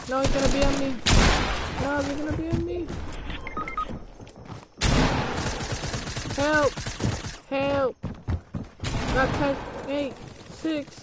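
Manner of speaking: high frantic panic; hyped gamer bro. Real, not acted. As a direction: yelp it out, pitch climbing. Dying in game, counting down.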